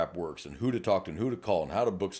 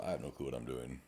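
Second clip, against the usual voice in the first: sheepish admission; 8 semitones lower, 13 dB quieter than usual. Retold or imitated. Rookie admitting cluelessness to officer.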